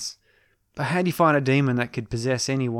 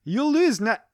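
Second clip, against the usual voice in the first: put-on accent slipping into Scottish, higher; a botched Scottish-sounding boss; 11 semitones higher, 5 dB louder than usual. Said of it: "I'm doing a bit Scottish".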